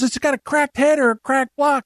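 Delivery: high exasperated father; imitating dad. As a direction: lay down the bad news as dad. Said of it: "He says".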